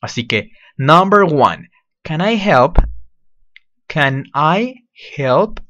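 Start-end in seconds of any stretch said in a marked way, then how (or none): 0.8-2.8 can I help, said clearly in English; model pronunciation. Shown as a demonstration. Teacher modeling "can I help?" for students. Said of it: "Listen to the pronunciation". 3.9-5.6 "Can I help?" drawn out very slowly, rising; slow model phrase. Shown as a demonstration. Teacher slowly modeling "Can I help?" for students. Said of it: "Listen to the pronunciation".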